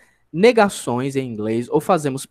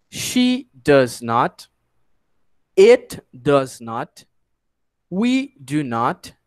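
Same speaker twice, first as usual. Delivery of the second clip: slow, word-by-word model phrases; repeat-after-me drill; 4 semitones lower, much slower than usual. Shown as a demonstration. Teacher has students repeat the conjugation, slow drill. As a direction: say each pronoun phrase slowly, spaced for repeating. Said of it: "repetisse comigo até pra você se acostumar".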